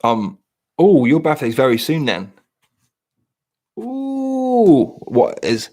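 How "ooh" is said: high, drawn-out ooh. Real, not acted. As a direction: coo in delight. Reacting to a near birthday.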